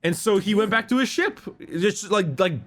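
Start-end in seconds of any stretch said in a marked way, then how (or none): none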